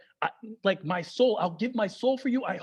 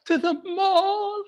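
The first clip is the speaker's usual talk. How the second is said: much higher, slow, aghast; shocked dad; 9 semitones higher, much slower than usual. Retold or imitated. Dad hears son is going to the mall, horrified. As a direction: drag it out in horror. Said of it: "He says it like".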